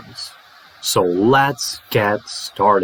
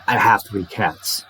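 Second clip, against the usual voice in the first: quick "I have three cats", HAVE still full; rapid; 3 semitones lower than usual. Shown as a demonstration. Teacher shows no change at speed, rapid. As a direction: hurry through "I have three cats" without reducing HAVE. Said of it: "Even if I say rapidly".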